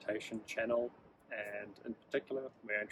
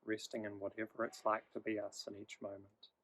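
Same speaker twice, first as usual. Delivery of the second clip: guided meditation. Acted. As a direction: ease the listener into stillness, gently.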